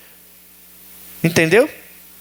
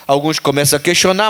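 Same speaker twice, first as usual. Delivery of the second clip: usual speaking voice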